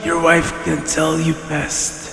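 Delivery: put-on quiet, fearful old servant; hushed, reluctant old herdsman; timid old shepherd.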